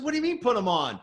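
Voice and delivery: baffled. Retold or imitated. MC baffled by partner's order.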